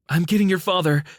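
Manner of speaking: high, loud frantic cry; mother shouting to her kids as she runs for their father; desperate shout. A narrator's reading. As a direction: yell it as you run back into the fire. Mother runs back into fire.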